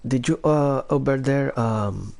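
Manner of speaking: Filipino accent. Shown as a demonstration. Showing off an impression by calling Fernando about his taxes, Filipino accent. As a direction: put on a Filipino accent, lifted in pitch. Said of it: "Here's my Filipino accent".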